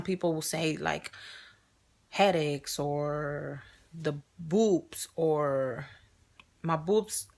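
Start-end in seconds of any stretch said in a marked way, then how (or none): none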